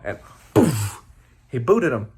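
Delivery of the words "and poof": plain reading voice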